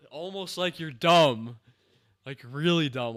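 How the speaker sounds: loud mocking scold; loud sarcastic jab. Real, not acted. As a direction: drag out the insult loudly. Mocking character's mistake.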